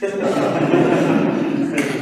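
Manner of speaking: a brief chuckle, breathy and unvoiced; a quick laugh at the story's payoff; short laugh. Real, not acted. Preacher laughing at the fallen nose, short chuckle.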